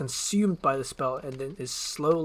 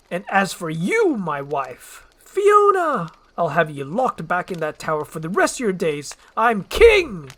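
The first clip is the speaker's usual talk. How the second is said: high, loud villain threat; loud, shrill-pitched gloat; gloating Farquaad; 4 semitones higher, 9 dB louder than usual. Acted. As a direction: lord it over her.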